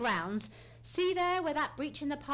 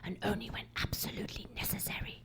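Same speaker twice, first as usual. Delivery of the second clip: whisper. Acted. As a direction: breathe the words so no one hears. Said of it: "it will be crucial to speak only like this".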